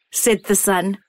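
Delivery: usual speaking voice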